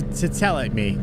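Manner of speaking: ordinary talk, nothing marked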